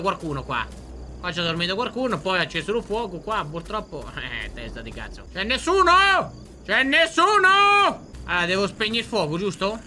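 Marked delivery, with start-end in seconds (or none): 5.3-8.0 high-pitched holler, stretched on the repeat; loud searching call. Real, not acted. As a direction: shout to see if anyone is there. Calling out for anyone.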